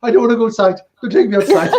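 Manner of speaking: high-pitched, imitated pleading; mock panicked plea. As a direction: act out a scared man begging, played for laughs. Retold dealer pleading for his life.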